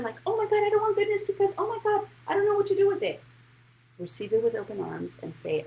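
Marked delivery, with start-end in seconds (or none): 0.3-3.2 much higher, fast, flustered mock panic. Mocking a viewer who blocks incoming goodness, squeaky panic. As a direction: parody someone overwhelmed by good fortune.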